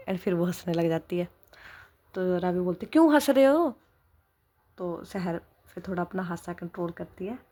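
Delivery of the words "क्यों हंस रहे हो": higher, louder, offended question; annoyed demand. Acted. Wife irritated by husband's laughter, offended question. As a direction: press him, irritated, why he laughs.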